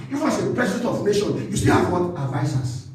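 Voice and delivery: usual speaking voice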